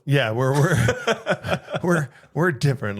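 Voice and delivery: chuckling through it, slowed down; laughing. Real, not acted. Cohost laughing as he admits their base is different.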